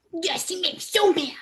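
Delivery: high-pitched, whispery mock tantrum; cute mad toddler. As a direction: imitate a tiny girl fuming in a high, breath-heavy squeal. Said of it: "She was so cute".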